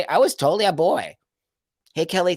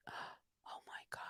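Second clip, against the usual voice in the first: breathless whisper of disbelief; 29 dB quieter, slower than usual. Real, not acted. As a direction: barely breathe it out, stunned. Stunned reaction to a viewer comment.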